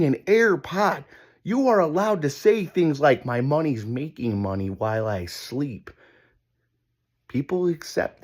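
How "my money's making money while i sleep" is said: low, smug, laid-back brag; smug boast. Retold or imitated. Guy with an AirPod bragging, low smug boast. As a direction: purr it like a rich guy who's got it made.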